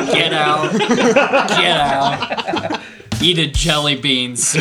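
laughing. Real, not acted. Loud laughing banter.

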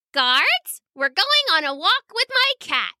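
put-on high girlish announcement; little princess. A narrator's reading. Little princess telling guards about her walk, girlish command.

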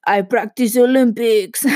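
a slow, hammed-up fake Greek accent; Michael Scott Greek accent. Imitating a sitcom boss faking a Greek accent. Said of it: "I can't do a Greek accent".

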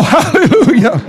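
joyful laugh. Real, not acted. Laughing joy mid-preach.

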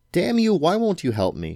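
anguished outburst; cried out. Acted. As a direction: yell at the cat in frustration. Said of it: "she cried".

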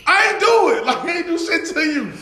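mock protest.

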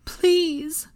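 high-pitched desperate plea; drawn-out, imploring cry; pleading. A narrator's reading. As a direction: beg with the voice lifted high. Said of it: "Molly pleaded at him".

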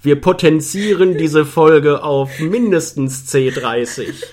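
loud, laughing mock boast; laughing loud joke. Real, not acted. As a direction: crow it while laughing.

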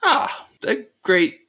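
lightly acted colonel, pitched high; colonel's approval. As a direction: praise an acquaintance in a high character voice. Colonel reacts to baker's name.

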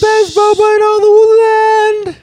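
high, loud, drawn-out mock proclamation; over-the-top town-crier yell; hammed-up proclamation. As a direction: proclaim it slowly to the whole kingdom. Hammed-up proclamation of boba shop.

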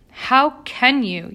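indignant friend fuming on the sidewalk. A narrator's reading. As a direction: bite out the question in anger. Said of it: "Yetta fumed".

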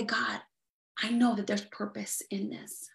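a soft, low spoken prayer; hushed prayer. Acted. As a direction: pray it under your breath. Voicing an example prayer.

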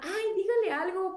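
imitated mum asking a favor; imitated pleading mother. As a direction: lightly plead like an exasperated mum. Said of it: "me dice la señora".

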